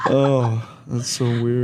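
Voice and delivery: laughing. Real, not acted. Laughing at friend's remark.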